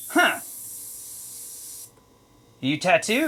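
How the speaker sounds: sudden high surprised huh, then a rising question; a friend's retold surprise on learning he tattoos. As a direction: catch him off guard, then ask. Retold meeting, Tony surprised.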